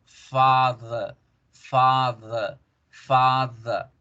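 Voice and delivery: "father" as FAAH-thuh, each one spaced out; lengthened A. Shown as a demonstration. Teacher drills the long vowel, lengthened A. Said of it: "alargo la vocal A".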